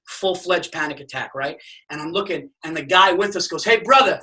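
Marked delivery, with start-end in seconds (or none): 3.6-4.2 lightly voiced Hawaiian guy, higher, louder. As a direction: call out like a friendly Hawaiian guide. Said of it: "He was, like, a Hawaiian guy".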